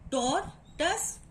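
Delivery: "Tortoise" split as tor, tus; syllable breakdown. Shown as a demonstration. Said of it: "Two syllable are here".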